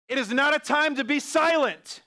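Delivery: loud and high, rallying the church; forceful preaching. Real, not acted. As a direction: call them out of silence, full force.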